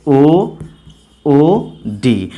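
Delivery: each letter spelled out slowly, with pauses; teacher dictating letters slowly. Shown as a demonstration. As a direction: spell the word out letter by letter for the class. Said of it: "तो अभी मैंने क्या बोला?".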